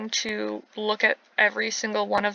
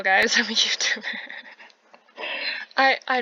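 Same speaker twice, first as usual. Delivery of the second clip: laughing. Real, not acted. Joke about dying in Sims.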